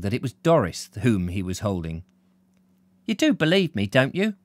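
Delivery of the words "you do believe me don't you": mildly anxious, high and pleading. Acted. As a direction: seek reassurance, pitched up at the start. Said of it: "she said anxiously".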